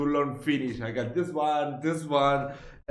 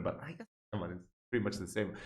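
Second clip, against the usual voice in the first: usual speaking voice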